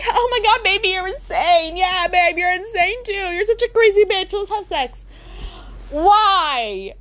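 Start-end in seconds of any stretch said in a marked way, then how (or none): 0.1-5.0 high, breathless gushing couple; mock lovers. Killer couple gushing after a murder, high mock. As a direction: gush at each other like crazed lovers. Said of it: "And they're like".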